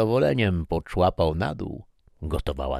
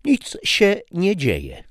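slow, much higher and louder, every word pressed; emphatic; 7 dB louder, slower than usual. Acted. Wife insisting nothing is wrong, slow emphatic. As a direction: lay heavy pointed weight on each word. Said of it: "dodała z naciskiem".

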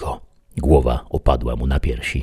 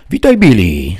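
deputy's greeting; 4 semitones higher, 7 dB louder than usual. A narrator's reading. Deputy calls greeting on entering.

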